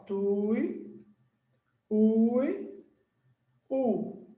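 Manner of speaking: TUI, then UI, then U, each alone; sounding out. Shown as a demonstration. Teacher breaking a word down for students, sounding out TUI, UI, U. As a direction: isolate each piece: tui, ui, u.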